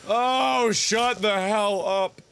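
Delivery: slow, drawn-out SHUT THE HELL UP; long exasperated groan of words; fed-up dismissal. Real, not acted. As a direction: stretch out every word in exasperation. Fed up, first take, drawn out.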